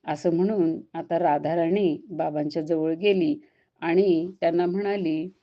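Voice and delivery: usual speaking voice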